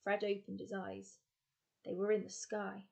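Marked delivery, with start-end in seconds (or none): none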